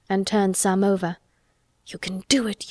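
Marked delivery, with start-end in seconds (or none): 1.9-2.7 whispered urging, much softer; said to herself. A narrator's reading. Mary watching Colin dig, whispering to herself. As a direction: whisper it under your breath like a private wish. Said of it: "said Mary to herself".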